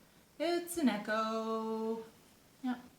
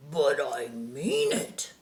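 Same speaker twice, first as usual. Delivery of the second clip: slow, lower bear insisting; growly bear; 4 semitones lower, 4 dB louder, slower than usual. A narrator's reading. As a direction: insist slowly like a big bear. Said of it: "said the large and growly bear".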